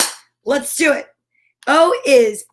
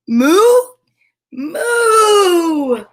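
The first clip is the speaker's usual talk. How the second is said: loud, higher, rising then long full moo; triumphant moo; 6 semitones higher, 6 dB louder than usual. Acted. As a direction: let the moo burst out at full length. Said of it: "finally went moo".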